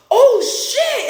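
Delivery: high, loud retold yelp; hammed-up startled cry; mock alarm. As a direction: blurt it as the crash looms. Acted alarm at near crash.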